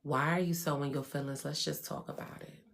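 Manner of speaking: soft, nearly whispered calm; coolly unbothered and soothing; low and quiet, almost unvoiced. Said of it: "I sit there calmly and say".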